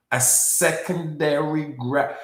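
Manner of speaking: usual speaking voice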